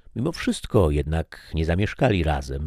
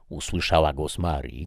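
ordinary delivery, nothing marked

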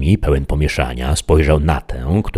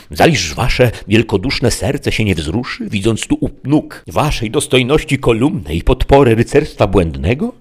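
Sancho; 6 semitones higher than usual. A narrator's reading. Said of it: "rzecze Sancho".

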